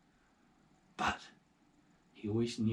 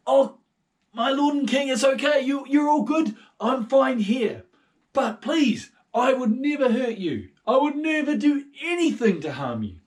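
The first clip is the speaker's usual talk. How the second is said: high loud humble servant; David calling up to King Saul; David answering; 17 dB louder than usual. Acted. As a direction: reassure the king loudly, voice up high.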